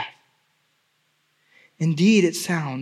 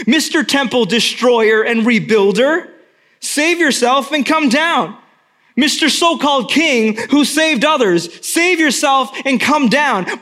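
rising from taunt to high loud jeer; building mockery; 5 semitones higher, 4 dB louder than usual. Mockers jeering Jesus, building to loud high scorn. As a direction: pile on the ridicule, louder each time. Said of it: "the mockers were saying this".

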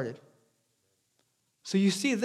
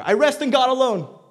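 loud, higher proclamation; ringing out louder, as a confident creed; bold declaration; 7 semitones higher, 6 dB louder than usual. Acted. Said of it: "too shaky to honestly declare".

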